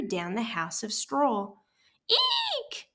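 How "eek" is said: very high squeal; shrill eek. A narrator's reading. Frightened pig squeals as the house blows down. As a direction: let out a tiny piercing squeak. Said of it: "squealed the little pig".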